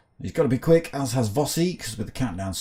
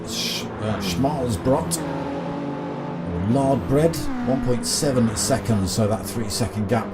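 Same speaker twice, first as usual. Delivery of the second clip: motorsport commentator; 3 semitones higher than usual. Acted. Live sim race commentary.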